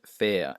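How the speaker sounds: British fair, said FEH-uh; non-rhotic fair ending in a schwa; silent final R. Shown as a demonstration. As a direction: drop the R at the end of fair. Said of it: "They don't s-, pronounce the R".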